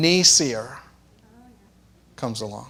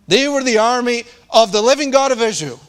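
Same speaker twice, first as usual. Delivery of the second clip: emphatic declaration; 6 semitones higher, 3 dB louder than usual. Real, not acted. Preacher's rebuttal, raised.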